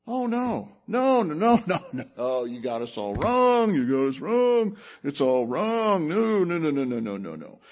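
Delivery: flustered brothers, stammering denial; panicked denial. Retold or imitated. The brothers pleading with Joseph, a flustered nervous denial. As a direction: wave it off nervously and trail away.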